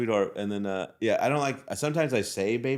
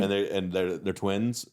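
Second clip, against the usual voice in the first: ordinary talk, nothing marked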